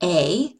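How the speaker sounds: "A" said as a long A, ay. Shown as a demonstration. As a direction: say a long "A" sound. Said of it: "the long sound would be A".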